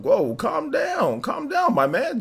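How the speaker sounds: high-pitched, drawn-out calm down; mock calm down. Streamer soothing a hyped chat in a high, slow voice.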